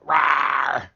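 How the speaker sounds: loud beastly roar; huge fiery dragon roar. Retold or imitated. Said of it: "Barney's like".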